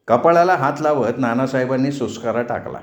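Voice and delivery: usual speaking voice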